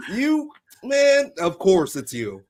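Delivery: high, loud "you" easing into slow disbelief; acted-out recognition. Retelling her reunion with a childhood friend, mock exasperated. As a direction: play mock exasperation at spotting an old friend. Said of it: "She's like".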